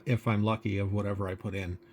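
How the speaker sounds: usual speaking voice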